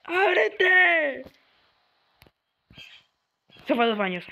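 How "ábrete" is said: high, loud frantic commands; panicked shouting. Real, not acted. Gamer begging the door to open, panicked high shouts. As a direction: shout it in a real fright. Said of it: "me asusté esta vez".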